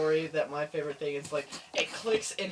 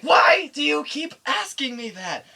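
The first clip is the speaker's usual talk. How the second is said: high, loud protest at mom; exasperated shout; 10 semitones higher, 7 dB louder than usual. Retold or imitated. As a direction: act out a fed-up kid yelling. Exasperated boy shouting at his mom.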